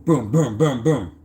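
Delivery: rhythmic run of booms; imitated shuffling noise. Storyteller mimics the woman shuffling cards, rhythmic sound effect. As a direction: beat out the shuffle in even booms.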